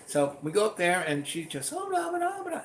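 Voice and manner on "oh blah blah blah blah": chattering mom. Retold or imitated.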